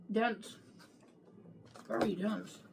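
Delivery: mouth full. Real, not acted. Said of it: "Look, I'm chewing a tire".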